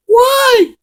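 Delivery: squeaky high mock whine; whiny why. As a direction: whimper it like a hurt brat. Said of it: "And then Taryn's like".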